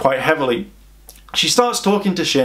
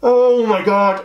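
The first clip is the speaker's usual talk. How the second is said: slow, higher "oh" of mounting irritation; annoyed; 6 semitones higher, 3 dB louder, slower than usual. Acted. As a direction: stretch it out in hammed-up annoyance. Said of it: "I'm getting annoyed".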